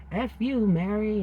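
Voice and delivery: high, loud snarl of a demon. Retold or imitated. Demon cursing at Mary in hell, shouting. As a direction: spit it at her like a demon. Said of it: "this demon saying".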